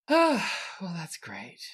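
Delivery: huge exasperated sigh, then dropping away; resigned, sighing let-down; big sigh. Acted. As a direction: heave a loud sigh, then deflate. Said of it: "Max heaves the biggest of sighs and says".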